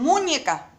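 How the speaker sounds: MUÑECA stressed on the first syllable, MU-ñe-ca; misplaced, punched stress on the MU of MUÑECA; wrong first-syllable stress. Shown as a demonstration. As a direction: say MUÑECA wrongly, MU high and loud. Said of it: "no es la sílaba tónica".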